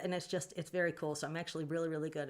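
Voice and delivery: ordinary talk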